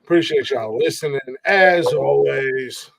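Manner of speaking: normal talking voice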